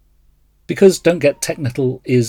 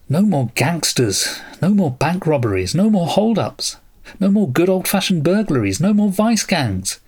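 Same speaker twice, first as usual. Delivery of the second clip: lachrymose lament; 6 semitones higher than usual. Acted. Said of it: "His voice grew lachrymose".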